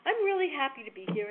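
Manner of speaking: upbeat speaker glad to be in New York; happy greeting. Retold or imitated. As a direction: beam at the audience as you begin. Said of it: "and I said".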